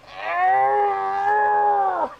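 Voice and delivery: loud high burst of laughter; big laugh. Real, not acted. Streamer cracking up after his mama impression, loud high laugh. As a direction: crack up at your own joke.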